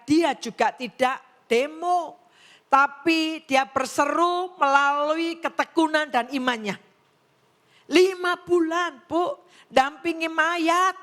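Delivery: slow down and preach it out with fire; impassioned pastor building to a peak; preaching at full force. Acted. Preaching climax on Rizpah.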